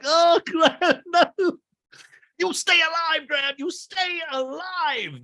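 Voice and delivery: begging the dog to live, overdone; mock sobbing plea. [name] sobs over his dog.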